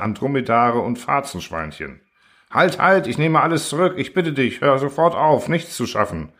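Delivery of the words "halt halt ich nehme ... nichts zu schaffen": full-throated cry. A narrator's reading. Constructor begging the machine to stop, crying out. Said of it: "schrie Klapautius aus voller Kehle".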